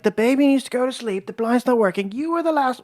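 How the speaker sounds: high pleading complaint, hammed up; mock whiny high voice. Husband mock-complains to his wife in a high whiny voice. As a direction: squeak out the complaint in a put-on high voice. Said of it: "We were fighting".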